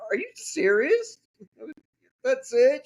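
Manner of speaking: high-pitched mock disbelief; incredulous. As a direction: imitate your own astonished question. Retold disbelief as nurse quits.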